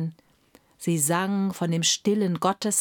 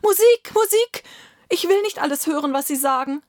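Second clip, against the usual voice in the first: high, loud cry, then fast protest; frantic sick emperor drowning out the voices; desperate screaming; 9 semitones higher, 5 dB louder, faster than usual. A narrator's reading. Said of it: "schrie der Kaiser".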